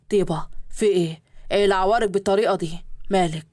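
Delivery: men's voices in a read story; low male guests. Acted. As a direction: play the businessmen, voice dropped low. Husband, low voice.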